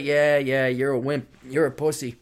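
put-on low, soft girlfriend taunt; dismissive mockery. Girlfriend mocking her crying boyfriend, low and dismissive.

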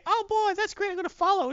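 high, gushing fake excitement; excited fan voice. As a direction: squeal it like an eager fan. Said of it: "You can say".

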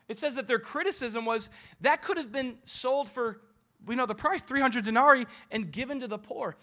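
high, loud impassioned preaching; full-force preaching. Acted. Pastor preaching at full force to his church. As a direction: preach it out to the congregation.

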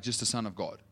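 usual speaking voice

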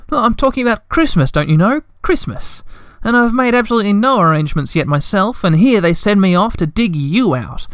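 high, hurried fretful Hemulen; nervous fluster. A narrator's reading. Hemulen complaining to Moomin Troll, flustered and nervous. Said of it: "the Hemulan said nervously".

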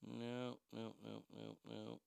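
very soft, low string of nos; quiet repeated no. Real, not acted. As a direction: trail off muttering no.

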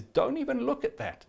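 sudden high light voice; bright high call of grace. Acted. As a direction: jump up high, lifting the listener. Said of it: "But grace says".

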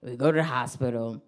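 ordinary delivery

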